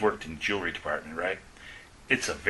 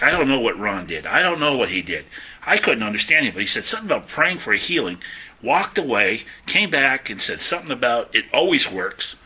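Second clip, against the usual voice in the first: loud, emphatic retelling of an astonished coworker; baffled excitement; 5 dB louder than usual. Retold or imitated. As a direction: blurt it out, punching each phrase. Coworker retells her baffling healing.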